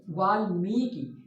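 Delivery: वाल्मीकि slow, long मी and short कि; teacher dictates वाल्मीकि; careful pronunciation. Shown as a demonstration. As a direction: spell it out slowly as वाल्मीकि. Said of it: "माँ में बड़ी ई".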